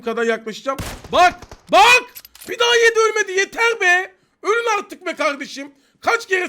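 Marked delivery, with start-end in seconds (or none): 1.1-6.5 real rage at an enemy who won't die; furious shout. Real, not acted. Gamer yelling at enemies who keep surviving, furious. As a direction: yell it high and loud, fed up. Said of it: "üç kere kardeşim dedim sinirimden".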